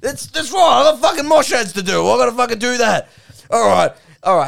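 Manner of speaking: high, loud, agitated ranting; Duck. Acted. Duck remembers he has ads to do, loudly worked up. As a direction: blurt it out at full pitch. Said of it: "aggressive Australian male".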